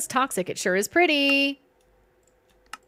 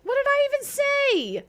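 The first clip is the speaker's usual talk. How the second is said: squeaky, much higher mocking voice; mock whiny echo; 13 semitones higher than usual. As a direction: parody your own protest in a silly squeak. Mocking own protest, friends teasing.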